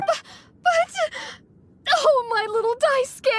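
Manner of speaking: high, fretful pleading; a mother near tears over her boy; anxious. Acted. Worried mother pleading for her son.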